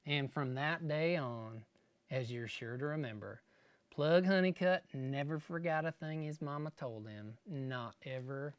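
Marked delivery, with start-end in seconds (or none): none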